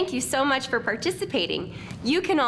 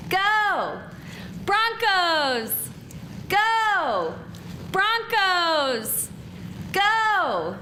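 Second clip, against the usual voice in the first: cheering; 8 semitones higher, much slower than usual. Real, not acted. Crowd cheer call and response.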